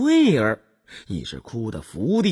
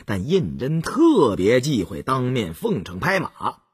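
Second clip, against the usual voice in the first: ordinary delivery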